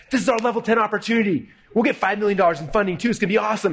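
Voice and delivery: high-pitched retold enthusiasm; all excited. Partners spot their big opportunity, excited. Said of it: "We're, like, all excited".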